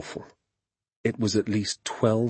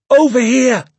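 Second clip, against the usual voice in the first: raised, high distant call; 11 dB louder than usual. A narrator's reading. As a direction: call back from far off in the woods. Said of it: "the voice called out".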